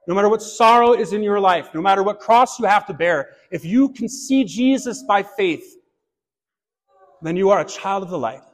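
normal talking voice

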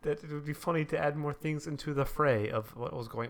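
formal announcer tone; public service announcement. Pretend. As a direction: read it like a serious PSA. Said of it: "This is a public service announcement".

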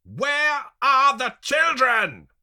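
very high, slow, full shout; each word yelled and drawn out; shouted demand. A narrator's reading. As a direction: demand it at the top of your voice. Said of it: "they demand again".

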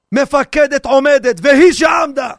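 slow, loud barked explanation; mocking commander. Army officer explains Haggadah to soldiers, loud mock shout. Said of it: "מה מסביר המפקד".

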